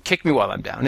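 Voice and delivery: ordinary delivery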